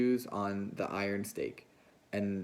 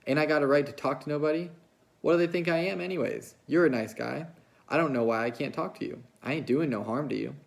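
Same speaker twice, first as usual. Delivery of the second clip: angry; 6 semitones higher, 3 dB louder than usual. A narrator's reading. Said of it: "Her face grew angry".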